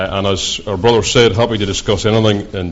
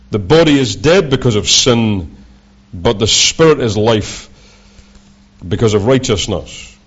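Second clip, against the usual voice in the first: scripture reading; 3 semitones higher than usual. Acted. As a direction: read the verses out like a preacher. Reads Romans aloud, preaching.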